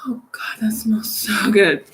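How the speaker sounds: whisper. Real, not acted. Savoring the smell of her food.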